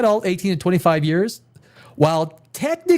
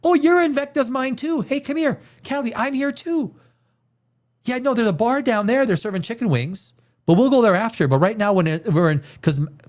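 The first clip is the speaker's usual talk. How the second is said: much higher, bubbly mock greeting; mock cheerful chat; 7 semitones higher than usual. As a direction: play a giddy kid bumping into a friend. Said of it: "And then you go".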